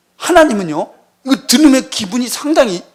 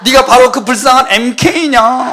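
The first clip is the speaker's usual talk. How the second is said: mock pity; 5 semitones higher, 6 dB louder, slower than usual. Mocks pitying church people.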